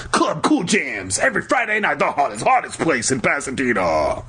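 hyped-up announcer plug; mock club advert.

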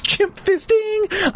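high slow squealed question; mock squeal. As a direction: squeal it like a joke. Joke chimp squeal.

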